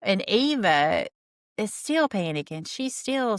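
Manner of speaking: normal talking voice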